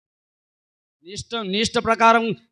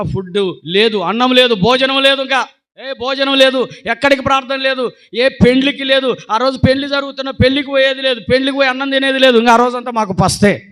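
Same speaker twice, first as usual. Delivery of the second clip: higher, louder rising list; preaching at full force; 6 semitones higher, 8 dB louder than usual. Acted. Preacher recounting the strict fast punishment, high and loud. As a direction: stack each no higher and louder.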